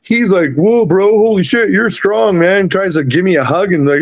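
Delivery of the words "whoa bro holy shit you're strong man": high, raised amazed bro voice; imitating a stunned golfer, pitched up; impressed bro. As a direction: gush like a dude who just lost a fight. Puncher impressed after fight.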